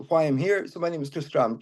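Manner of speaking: usual speaking voice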